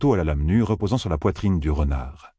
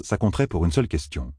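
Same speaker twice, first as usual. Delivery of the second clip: normal talking voice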